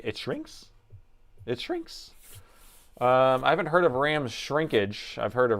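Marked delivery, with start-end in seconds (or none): 1.5-2.1 much higher, disbelieving question; incredulous squeak. Real, not acted. Streamer repeats chat's ram shrinkage claim, incredulous high squeak. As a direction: squeak it out like you can't believe it.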